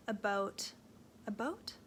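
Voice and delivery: "about?" tried out with a rising, unsure tune; testing how "about" sounds, half asking; "about" said quietly as a puzzled question. Shown as a demonstration. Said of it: "Do I say about?".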